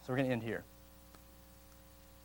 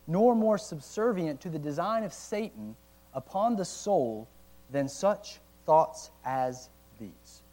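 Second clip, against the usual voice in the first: slow and louder, stretching each harsh word; reading slowly; 3 semitones higher, 4 dB louder, slower than usual. Real, not acted. Pastor reading Owen on harsh thoughts of God, slow and weighty. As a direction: lean into each severe adjective slowly. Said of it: "I have to read it slow".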